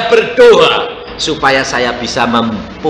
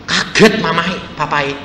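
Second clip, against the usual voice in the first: usual speaking voice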